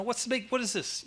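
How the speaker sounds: high, indignant protest; mock outrage at the father; indignant son. Elder son protests to father, high indignant.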